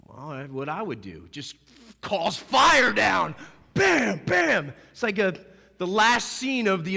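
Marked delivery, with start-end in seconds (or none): 2.0-4.7 mock fire from heaven. Imagines Jesus calling down fire.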